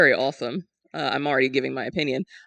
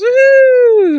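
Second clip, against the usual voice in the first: much higher and louder whoop of joy; excited cheer; 13 dB louder than usual. Real, not acted. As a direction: cheer like the crowd just went wild. Cheering the showcase news.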